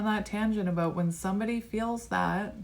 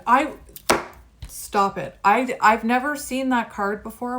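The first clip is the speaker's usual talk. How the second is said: real outburst, loud and higher; excited yelling; 4 semitones higher, 8 dB louder than usual. Real, not acted. Tarot reader yells at the viewer over a never-seen card, loud disbelief. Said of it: "Why am I yelling at you?".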